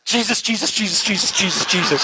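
mock nervous prayer, the name said over and over; panicked praying. Jimbo praying in a panic as the preacher boasts, mock anxious. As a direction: mutter Jesus repeatedly in a mild panic. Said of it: "He started praying".

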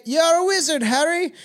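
high, playful British movie-quote voice; exaggerated British delivery; British accent. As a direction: put on a British accent for the catchphrase. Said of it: "A little British boy".

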